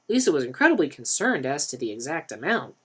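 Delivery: ordinary delivery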